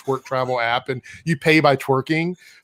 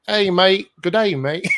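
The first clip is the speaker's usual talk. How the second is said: put-on nasal Aussie greeting, breaking into laughter; hammed-up Australian impersonation; nasal Australian accent; 4 semitones higher, slower than usual. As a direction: try an Australian accent through the nose. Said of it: "is it like a nasal thing?".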